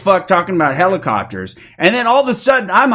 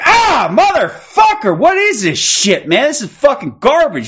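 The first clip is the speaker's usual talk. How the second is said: disgusted outburst; 9 semitones higher, 3 dB louder than usual. Retold or imitated. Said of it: "I'm like".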